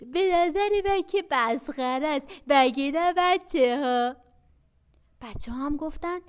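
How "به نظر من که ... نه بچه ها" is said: louder, slowing coaxing question; sly bag. Acted. Bag persuading the children, loud and slow. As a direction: win the kids over like a crafty sack. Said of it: "کیسه گفت".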